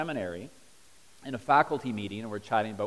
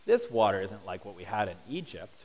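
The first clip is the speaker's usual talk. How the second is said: fussy complaint; 3 semitones lower than usual. Retold or imitated. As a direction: sound like a spoiled diner. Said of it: "and they say".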